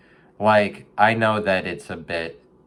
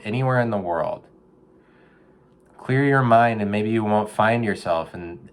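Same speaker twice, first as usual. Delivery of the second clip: gentle life-coach advice; 4 semitones higher than usual. Retold or imitated. Advising a raccoon in a storm drain, earnest counselor voice. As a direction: talk the raccoon through it like a therapist. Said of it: "I'm like".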